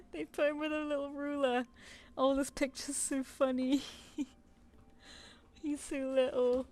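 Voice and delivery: adoring. Real, not acted. Cooing over tiny plush.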